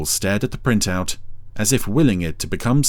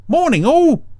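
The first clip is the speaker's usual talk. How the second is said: much higher, louder snail voice; Brian voice; 6 dB louder than usual. A narrator's reading. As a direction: chirp it out high and cheery. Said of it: "said Brian".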